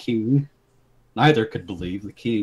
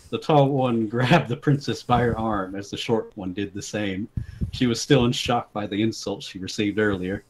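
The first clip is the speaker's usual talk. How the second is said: ordinary talk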